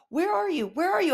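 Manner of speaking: high sing-out, calling for mom; high-pitched mock kid call; imitating searching kid. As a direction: mimic a child hunting through the house. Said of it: "he's always trying to find me".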